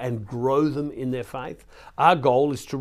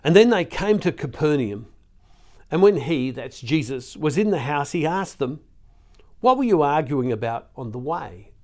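louder, lifted Bible-reading voice; scripture reading; 2 semitones higher, 5 dB louder than usual. Acted. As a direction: read the passage out with emphatic projection. Reads scripture aloud to listeners.

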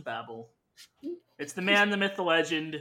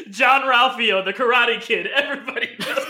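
laughing; 4 semitones higher, 6 dB louder than usual. Real, not acted. Laughing, loud.

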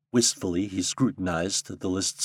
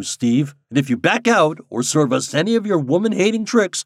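high, loud mock threat; mock-stern friend; 8 semitones higher, 6 dB louder than usual. A narrator's reading. Kravitz mock-threatening Steve about his wife, high and loud. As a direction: bluster at him half in jest.

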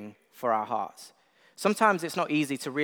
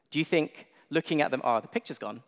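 normal talking voice

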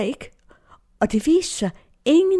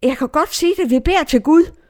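higher, lightly voiced youngsters; anxious young confirmands; 7 semitones higher than usual. Confirmands run to their leader during the search, higher retold urgency. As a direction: imitate confirmands running up with an urgent question. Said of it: "Konfirmander kom rendende hen til mig".

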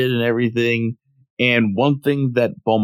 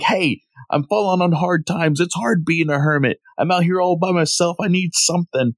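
higher-pitched hard-luck plea, played for laughs; mock pleading; 7 semitones higher than usual. Hermit to scolding turtle after falling on hard times, mock pleading. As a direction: imitate a put-upon hermit making excuses.

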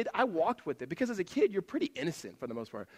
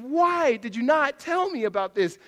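loud, slow, incredulous demand; confronting an old friend, loud and slow; indignant question; 9 semitones higher, 8 dB louder, slower than usual. Retold or imitated. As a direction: drag it out in disbelief. Confronting friend about gospel.

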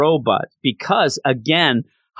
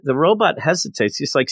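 ordinary talk, nothing marked

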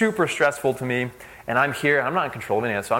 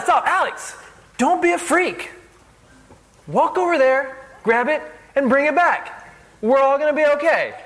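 much higher, louder, half-laughing scold; humorous chastising; 5 dB louder than usual. Retold or imitated. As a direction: rein her in with a joking loud call. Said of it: "chastise with humor".

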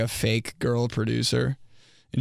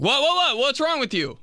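exasperated; 14 semitones higher than usual. Retold or imitated. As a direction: demand it way up high. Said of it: "I'm like".

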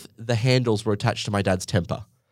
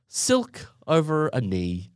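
slow drawn-out silky voice; silky smooth; 5 semitones higher, slower than usual. Shown as a demonstration. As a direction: stretch it out smooth as silk. Describing very smooth calm cashier.

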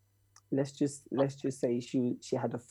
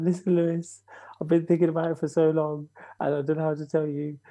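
put-on girl's nervous confession; 4 semitones higher, 3 dB louder than usual. Girl confessing at the door.

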